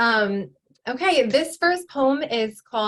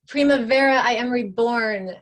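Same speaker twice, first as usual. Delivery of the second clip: raised, louder cry within a poem; mild poetic screech; 2 semitones higher, 4 dB louder than usual. Acted. Poet voicing the reborn figure's cry, mildly screeched. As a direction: lift it into a triumphant proclamation, short of screeching.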